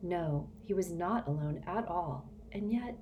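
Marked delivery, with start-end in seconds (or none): none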